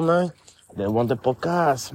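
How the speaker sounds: normal talking voice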